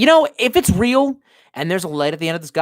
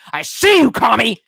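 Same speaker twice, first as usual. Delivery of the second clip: much higher and louder, pointing someone out; mock call-out yell; 7 semitones higher, 7 dB louder than usual. Streamer calling out an imagined commie, mock yell. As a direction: shriek the accusation in fun.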